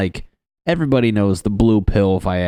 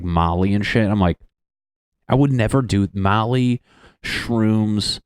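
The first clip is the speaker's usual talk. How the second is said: usual speaking voice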